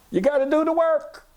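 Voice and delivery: high-pitched, insistent cry; very high, held-up exhorting tone; emphatic exhortation. Real, not acted. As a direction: pitch it way up and urge them. Exhorting the listeners after a laugh.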